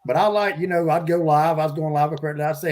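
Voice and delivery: ordinary talk, nothing marked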